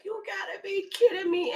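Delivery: high, incredulous squeal; retold stunned cry; mock disbelief. Disbelief as weed spills.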